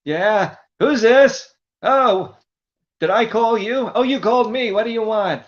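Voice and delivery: put-on high, loud, forgetful old guy; Buddy voice. Buddy answering a telemarketer, high, loud and confused. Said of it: "the voice I would use on telemarketers".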